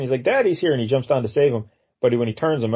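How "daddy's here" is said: higher-pitched, playacted fatherly call; mock rescue cry. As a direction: rush in as the doll's daddy, tongue in cheek. Acting out rescue of Cheeks.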